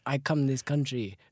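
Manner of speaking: amateur put-on Italian accent; halting mock-Italian English. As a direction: play an Italian with broken English. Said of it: "attempt an Italian accent now".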